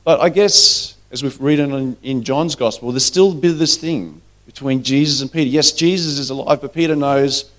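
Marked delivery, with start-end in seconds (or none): none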